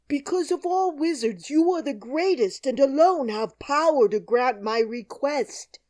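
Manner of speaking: pleading lion. A narrator's reading. The lion flattering Oz, high and pleading. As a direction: plead up high and loud to the great wizard.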